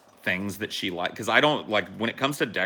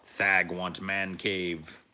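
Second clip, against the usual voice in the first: caveman voice; much slower than usual. Acted.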